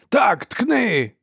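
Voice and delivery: high yell, meant as through a chuckle; gleeful shout. A narrator's reading. Brother eggs soldier on, shouting gleefully. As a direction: egg him on gleefully. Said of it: "крикнув її брат крізь смішок".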